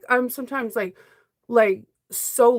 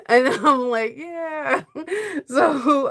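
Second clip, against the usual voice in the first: teary laugh; 4 semitones higher, much slower than usual. Real, not acted. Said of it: "I'm gonna try not to cry".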